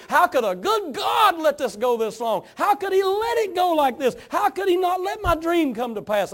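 high, loud cry of disbelief; anguished protest. Acted. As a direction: shout the accusing questions up at God. Voicing the congregation's protest to God.

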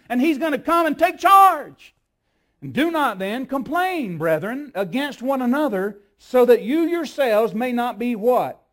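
loud, high, pounding sermon climax; full-force preaching. Acted. Preacher warning the church the judge is at the door, full force. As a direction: thunder it out, each line a hammer.

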